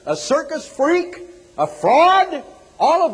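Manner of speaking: high-pitched, emphatic list of charges; outraged roll-call, each item ringing high; indignant denunciation. Real, not acted. Denouncing a TV preacher, item by item.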